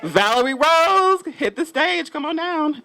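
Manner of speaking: game-show announcer, high and drawn-out name call; Bob Barker voice. Acted. Host calling the artist up to the stage, game-show announcer style. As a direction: summon a contestant down in a big, high holler. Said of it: "in my Bob Barker voice".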